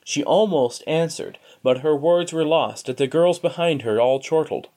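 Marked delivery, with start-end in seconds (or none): none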